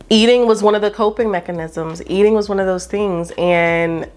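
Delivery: ordinary talk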